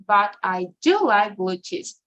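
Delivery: "do" stressed hard for emphasis; emphatic "do" standing out from the sentence. Shown as a demonstration. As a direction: punch "do" to contradict what came before. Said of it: "it is always stressed".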